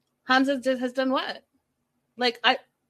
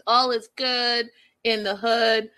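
ordinary talk

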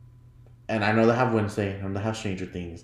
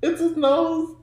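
high, slow, giggling through the words; laughing disbelief; 11 semitones higher, slower than usual. Retold or imitated. Retelling her reaction to the zoomed-in nose, giggling.

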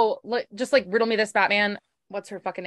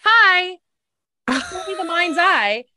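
higher, slow, eerie greeting; creepy visitor; 6 semitones higher, 5 dB louder, much slower than usual. Retold or imitated. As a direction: voice him like a ghost sliding in. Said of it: "literally goes".

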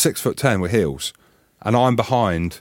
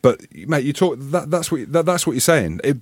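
usual speaking voice

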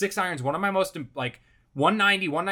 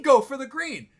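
high-pitched, exasperated repeat of the order; incredulous echo; 8 semitones higher, 4 dB louder than usual. Retold or imitated. Golfer questioning his caddy's advice, incredulous. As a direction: throw the words back in disbelief. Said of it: "What do you mean".

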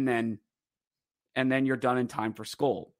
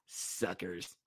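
much softer, under the breath; muttered aside; 7 semitones lower, 16 dB quieter than usual. Real, not acted. Vlogger taunting the fans who wanted the fight, muttered aside. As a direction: mutter it to yourself. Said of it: "muttered aside".

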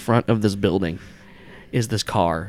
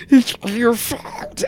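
high, loud, drawn-out creature voice; villain's loud accusation; accusing creature; 14 semitones higher, 6 dB louder, much slower than usual. Acted. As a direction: hurl the blame in a slow, high cry. Creature turns on player, blaming.

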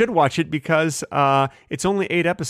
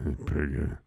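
It's pretty good mumbled much lower and softer; dismal pretty good; 11 semitones lower, 10 dB quieter than usual. Shown as a demonstration. Showing the one-out-of-ten version, low mumble. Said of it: "here's how you say it".

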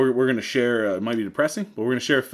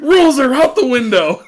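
excited shout; 12 dB louder than usual. Real, not acted. Hyped shout after laughing.

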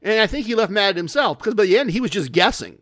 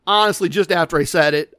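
plain reading voice

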